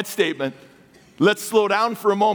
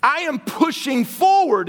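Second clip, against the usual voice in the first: voicing Paul; 7 semitones higher than usual. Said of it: "So he's like".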